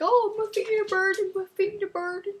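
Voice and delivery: high hammed-up cry about burning feet; mock Falco. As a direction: whine it like a cartoon victim. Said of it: "He was just like".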